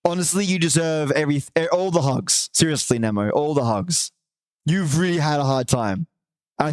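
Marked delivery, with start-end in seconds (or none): none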